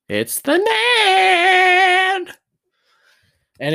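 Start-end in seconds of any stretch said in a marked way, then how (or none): 0.1-2.3 very high, loud, slow sing-song announcement; imitating sister. Retelling the sister jumping into the room, high slow mock announcement. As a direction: belt it out like the sister bursting in. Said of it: "and goes".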